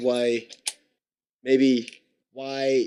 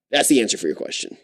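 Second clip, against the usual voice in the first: usual speaking voice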